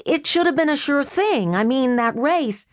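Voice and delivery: lightly voiced brother making excuses; Freddy's voice. Brother excusing his lost bet to his sister, lightly voiced sheepish.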